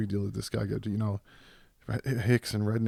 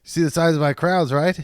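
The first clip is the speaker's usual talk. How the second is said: higher, louder boastful mashup impression; Norm Macdonald Trump; 6 semitones higher, 7 dB louder than usual. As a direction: brag about yourself, fishing for agreement. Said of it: "do an impression of a character".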